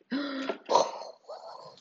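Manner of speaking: sudden amazed gasp; sharp gasp. Retold or imitated. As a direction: gasp in disbelief. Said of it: "my brain exploded".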